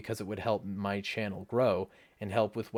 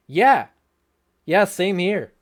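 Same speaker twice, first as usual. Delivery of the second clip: emphatic agreement; 6 semitones higher, 10 dB louder, slower than usual. Real, not acted. Agreeing loudly with viewer's question.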